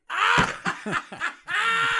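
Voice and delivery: big high cackle at his own joke; loud high laugh. Real, not acted. [name] laughs loudly at his own joke.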